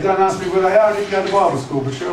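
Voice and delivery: normal talking voice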